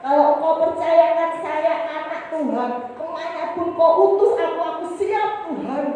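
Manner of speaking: high, loud cry of a vow to God; impassioned vow. Retold or imitated. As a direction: pour out the promise at full voice. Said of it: "saya pernah nasar".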